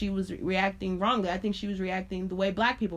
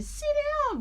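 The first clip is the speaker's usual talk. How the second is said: much higher, mocking voice of the teacher. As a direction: mimic a fussy teacher in a high squeak.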